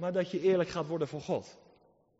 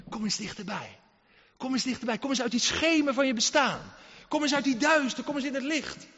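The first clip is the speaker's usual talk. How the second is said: a pleading altar call that grows louder; preaching appeal; 5 semitones higher, 3 dB louder than usual. Acted. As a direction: swell each "kom eens" into a rousing plea.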